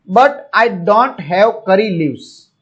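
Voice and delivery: each word spelled out slowly; slow, careful English for a Gujarati class; slow dictation reading. Shown as a demonstration. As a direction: read it very slowly so learners catch every word. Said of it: "teacher reads English line".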